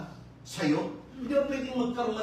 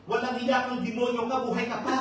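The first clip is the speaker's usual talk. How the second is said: a louder, higher mock scolding; neighbor's mock yell; 5 semitones higher, 5 dB louder than usual. Preacher imitates the neighbor cursing her family, mock angry yell. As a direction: imitate the shrieking neighbor, half in fun. Neighbor woman yelling at someone.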